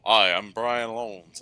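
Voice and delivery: slow, smooth documentary voice; putting on a polished presenter voice; smooth narrator voice. Retold or imitated. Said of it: "it's like".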